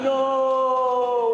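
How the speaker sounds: loud, high dramatic no; mock heroic shouted no; war movie refusal. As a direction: refuse like a loyal comrade in a film. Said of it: "it was like a war movie".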